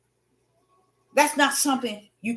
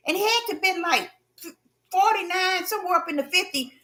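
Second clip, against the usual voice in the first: ordinary talk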